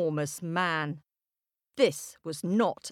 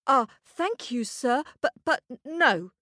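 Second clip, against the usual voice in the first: put-on polite boy; 7 semitones higher than usual. Jack voice.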